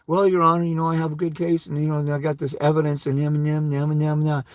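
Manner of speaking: soft, low-energy courtroom plea; mock timid litigant, softly droning on; passive, gentle mumble. Shown as a demonstration. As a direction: trail into a meek, monotone mumble. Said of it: "passively and gently and softly say".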